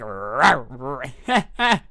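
mock growling bark; teenager's dog noises, imitated for laughs. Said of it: "barking at you like".

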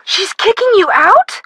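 can't believe what she's hearing; shocked disbelief. Acted. Shocked at Katie's decision.

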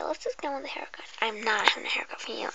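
soft unvoiced whisper to the viewer. Whispering to the viewer before the pretend hairdresser game. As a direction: breathe every word like an ASMR intro.

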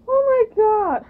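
high loud oh my god; shocked shriek. Real, not acted. Shock reaction.

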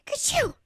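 loud, high acted sneeze. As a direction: sneeze it out big. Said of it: "Peter sneezed".

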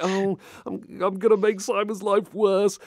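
high hammed-up fretting; mock worry. Streamer retelling his fear for Simon, high mock worry.

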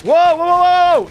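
loud rising whoas; startled. Real, not acted. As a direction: caught off guard by a sudden attack. Suddenly attacked by mobs.

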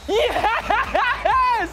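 high, exultant yell; high-pitched victory cry; triumphant shout. Real, not acted. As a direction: shout it out as the winning goal lands. Scores late in the match.

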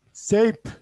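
Seyp said slowly and clearly for students to repeat; Urdu word Seyp. Shown as a demonstration. Teacher to online Urdu class: Seyp said clearly and a little loud. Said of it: "teacher models Urdu word".

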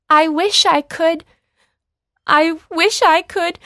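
high, loud, mock sobbing plea; hammed-up weeping, begging; tearful. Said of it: "not able to speak from all the tears".

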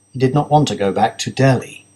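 plain reading voice

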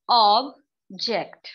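Object in two beats, ऑब्ज and ेक्ट, with a pause; sounded out slowly. Shown as a demonstration. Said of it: "मैं इस वक्त अब बोल रही हूँ इस साउंड को".

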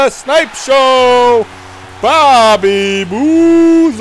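drawn-out announcer-style call; hype shout. Real, not acted. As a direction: call the name like an arena announcer. Hyping his own player name.

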